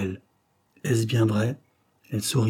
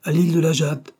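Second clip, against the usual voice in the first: raised, higher call to a driver; 7 semitones higher, 5 dB louder than usual. A narrator's reading. Doctor to his coachman from the carriage, calling out. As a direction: call the destination out, moderately loud. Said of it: "cria au cocher".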